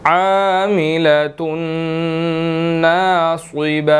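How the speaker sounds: slow melodic tajweed chant; Quran recitation. Shown as a demonstration. Teacher recites the next verse twice, slow chant. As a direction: stretch each word into recitation. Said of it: "next آیت کی طرف چلتے ہیں".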